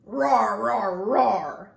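loud, low rolling roars; roaring bear. A narrator's reading. Bear stomping down the mountain, loud low roaring. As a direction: roar in rhythm like a stomping bear.

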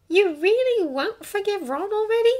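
high-pitched, tentative question. A narrator's reading. Hermione asking Draco about Ron, tentative. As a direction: broach it cautiously in a high voice. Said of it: "asking tentatively".

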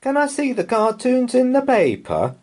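put-on high eager child asking; child's voice. A narrator's reading. Laura asking mum for the paper, high child voice. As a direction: pitch right up like a little girl.

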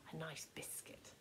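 soft unvoiced whisper. A narrator's reading. Narrator winding down the cosy scene, whispered. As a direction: breathe it like a bedtime secret.

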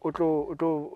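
ordinary talk

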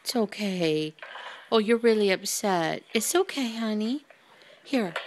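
soft low comforting voice; calm a frightened dog gently; soothing. Real, not acted. Comforting upset dog, softer lower.

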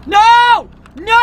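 very high, loud shriek; startled scream. Real, not acted. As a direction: scream at the scare. Jump scare reaction.

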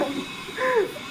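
short high-pitched laugh; a quick, high burst of amusement; amused laugh. Real, not acted. Teacher joking about a plea for help, amused laugh.

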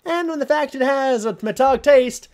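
high, loud sarcastic rant. Real, not acted. Ranting at the note writer, high and mocking. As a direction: rant at his obliviousness.

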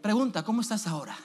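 ordinary talk, nothing marked

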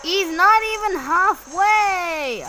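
high, loud, drawn-out wail of dismay; exasperated cry. Real, not acted. Dismay at the boss's health.

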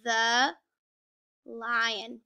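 very drawn-out words, one at a time; reading together slowly. Shown as a demonstration. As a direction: lead young readers slowly through each word. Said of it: "So let's read that together".

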